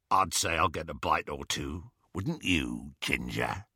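low, soft leering taunt; leering werewolf. A narrator's reading. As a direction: taunt him softly. Greyback leering.

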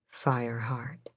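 whispered, barely audible; soft whisper. A narrator's reading. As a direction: breathe it under the waves. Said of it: "He said softly, barely audible".

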